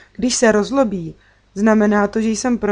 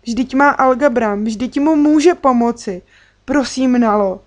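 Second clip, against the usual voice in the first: loud, high-pitched pleading; urgent imploring appeal; begging; 5 semitones higher, 5 dB louder than usual. A narrator's reading. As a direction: plead desperately with a friend. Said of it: "se sepjatýma rukama a prosila".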